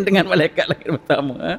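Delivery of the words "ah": ordinary talk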